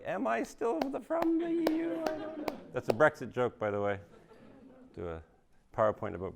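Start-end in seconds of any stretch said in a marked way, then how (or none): 0.0-2.5 high, lost mock British voice; a hammed-up puzzled British person; confused Brit. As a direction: play a bewildered Brit, voice pitched way up. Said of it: "a British person, they're like".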